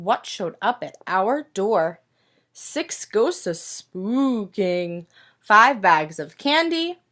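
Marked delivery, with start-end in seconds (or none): 2.5-5.1 slow, hushed spooky ghost voice; hushed storybook spookiness. Acted. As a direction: draw it out like a ghost telling a story to kids. Ghosts in spooky counting book.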